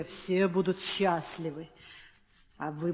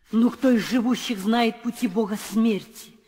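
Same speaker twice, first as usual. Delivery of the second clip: louder, higher foreboding chant; ominous warning; 5 semitones higher, 8 dB louder than usual. Acted. Nurse warns the queen ominously about the king's death.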